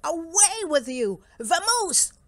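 high, loud bear shout; exasperated, booming order; angry dismissal. Acted. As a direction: shoo the pest away at full voice.

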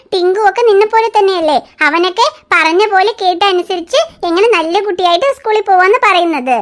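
ordinary talk

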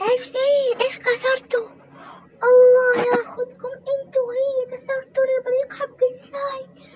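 high, loud wailing rage; furious mother-in-law. Acted. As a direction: scream at them over the broken teapot. Mother-in-law raging over broken teapot. (الحماة تصرخ غاضبة على إبريق الشاي المكسور)

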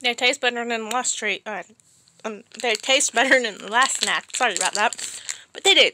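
chewing while talking; speaking around food; mouth partly full. Real, not acted. Seen 3: chews before, mouth working between words while talking.

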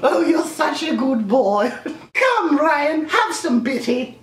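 high, slow domineering queen; evil queen voice. Pretend. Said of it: "like the queen".

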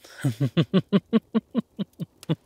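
high, loud, rippling chuckle; rising giggly chuckle that falls off at the end; amused laugh. Acted. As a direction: laugh in a long bouncing run. Amused by partner's unheard reply.

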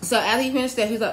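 normal talking voice

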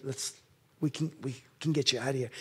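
soft, fast hushed plea; quiet urgent coaxing. Retold or imitated. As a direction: hurry him along quietly.

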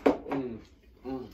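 chewing a chip; eating while talking; mouth full. Real, not acted. Seen 1: left man lifts chip into open mouth; unclear who speaks.

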